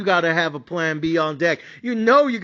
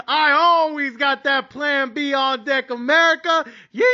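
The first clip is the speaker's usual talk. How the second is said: building, slowed-down shout of a signature line; catchphrase shout; 6 semitones higher than usual. Retold or imitated. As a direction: belt the podcast catchphrase to the crowd. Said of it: "what do I always say on the podcast".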